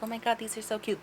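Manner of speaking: high, soft cutesy gush; hint of a girly squeal; mock gushing. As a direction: coo over the dolls. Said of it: "They're not, like, super cute".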